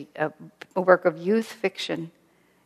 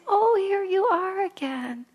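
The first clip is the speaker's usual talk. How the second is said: cheerful greeting; 13 semitones higher, slower than usual. Acted. Astral beings greeting a returning soul.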